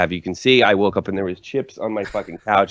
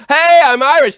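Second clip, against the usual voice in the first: high, loud mock American tourist; 9 dB louder than usual. To Americans in Europe, mocking a tourist claiming to be Irish. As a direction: squeak out a wannabe Irish boast.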